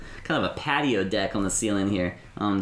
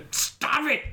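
angry NPC. Acted. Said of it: "pretty angry, um, pretty out of sorts".

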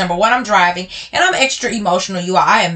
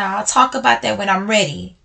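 ordinary talk, nothing marked

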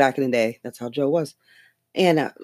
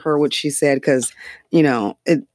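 ordinary talk

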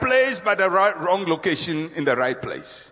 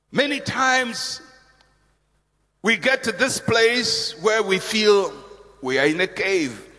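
usual speaking voice

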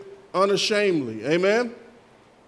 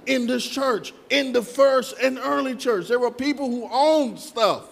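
raised-pitch, insistent sermon voice; fervent preaching; 6 semitones higher than usual. Real, not acted. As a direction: press the point on the congregation.

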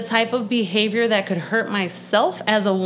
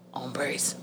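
low, muted mock repeat; soft, low-pitched mockery; mocking idiot; 9 semitones lower, 12 dB quieter than usual. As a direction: mimic the word dismissively under your breath. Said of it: "Sound like a fucking idiot".

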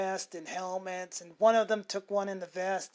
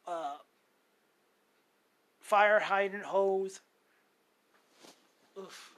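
normal talking voice

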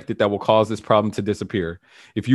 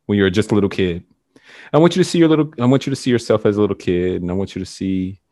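guided meditation; 4 semitones lower, 3 dB louder than usual. Acted. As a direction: lead them gently down the timeline. Guided regression, drawn out.

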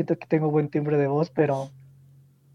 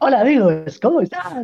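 high, bright-pitched youtuber hello; cheery channel-intro voice; youtuber greeting; 8 semitones higher, 3 dB louder than usual. Retold or imitated.

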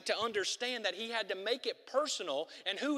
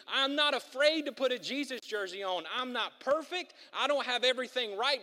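loud, rising preacher's proclamation; preaching at full force; 5 semitones higher, 4 dB louder than usual. Acted. Pastor urging the church, preaching at full force. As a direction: drive it home to the congregation.

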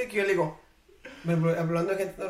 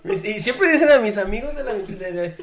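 laughing; 3 semitones higher, 7 dB louder than usual. Real, not acted. Mocking how people say it.

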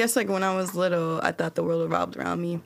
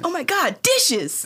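mock excitement; 10 semitones higher, slower than usual. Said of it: "It's like".

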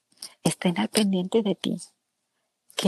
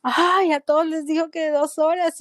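amazed; 8 semitones higher than usual. Retold or imitated. Patient amazed the others must wait two hours, high surprise. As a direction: gasp it out, pitch up high.

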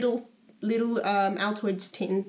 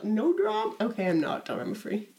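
normal talking voice

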